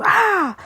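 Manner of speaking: sudden high mock scream; imitating a scream. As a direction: squeal it out like the TikToker. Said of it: "he literally goes".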